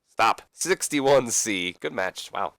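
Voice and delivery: ordinary delivery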